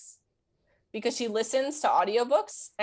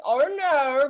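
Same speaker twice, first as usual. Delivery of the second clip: put-on high, louder "Oh, no" in a strong accent; 6 semitones higher, 5 dB louder than usual. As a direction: stretch out "Oh, no" like a thick accent. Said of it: "a verbal stim".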